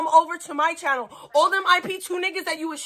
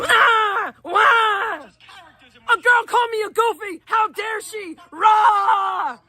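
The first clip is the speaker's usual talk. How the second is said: mock crybaby wail; 5 semitones higher, much slower than usual. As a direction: wail like a spoiled toddler throwing a tantrum. Mocking rival as a crybaby.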